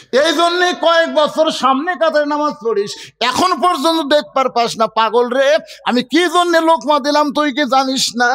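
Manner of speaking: high, insistent, exasperated scolding; scolding retort. Acted. Shepherd berating the man beside him, indignant scolding.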